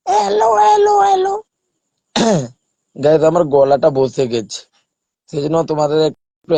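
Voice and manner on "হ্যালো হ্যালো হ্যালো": very high put-on squeaky hellos; acting voice. Child acting for viewers, squeaky repeated hello. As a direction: call out in a tiny cartoon voice. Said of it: "এটাও আমি acting করতে পারি".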